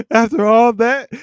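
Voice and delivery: high, loud words through laughter; cracking up while talking; helpless laugh breaking into the words. Real, not acted. Cracking up at a chat comment.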